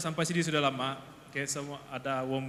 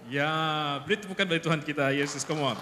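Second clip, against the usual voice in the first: ordinary delivery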